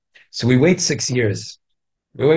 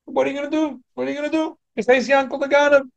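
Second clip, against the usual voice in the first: high-pitched mock exclamation, much higher; mocking the thief; 12 semitones higher, faster than usual. Teacher mocking the thief who shrugs off his stealing, high mock cry. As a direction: scoff at him as Yankel the thief in a raised voice.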